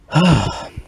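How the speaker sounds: heavy sigh of frustration; exasperated sigh. Real, not acted. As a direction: let out a fed-up sigh. Fed up with the show.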